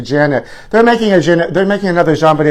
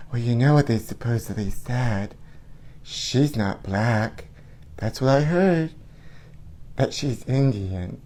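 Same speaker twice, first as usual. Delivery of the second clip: lightly voiced soft-spoken pop star; 2 semitones lower, 9 dB quieter than usual. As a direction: breathe it like a shy pop diva. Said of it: "I'm gonna do it in [name]'s voice".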